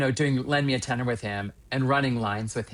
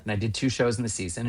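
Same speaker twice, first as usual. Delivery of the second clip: ordinary delivery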